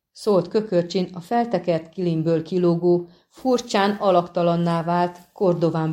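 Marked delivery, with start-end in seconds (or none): none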